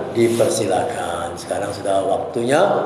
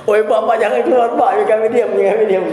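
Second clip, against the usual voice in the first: pleading pupils; 13 semitones higher than usual. Retold or imitated. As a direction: beg the teacher to stay. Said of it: "Kalau budak ribut".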